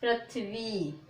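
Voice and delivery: पृथ्वी spoken slowly, the half थ run into वी; पृथ्वी read out slowly as an example word; slow teaching demo. Shown as a demonstration. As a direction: model पृथ्वी slowly for the class. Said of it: "कैसे?".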